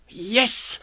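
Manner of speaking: excited cheer. Acted. Alfie cheering about the promotion.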